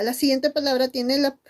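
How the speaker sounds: normal talking voice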